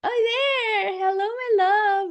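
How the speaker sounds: high, loud, delighted hello; gushing greeting. Real, not acted. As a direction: greet a loved friend with a squeal of welcome. Greeting someone who appears on camera.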